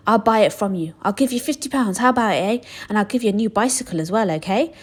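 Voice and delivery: usual speaking voice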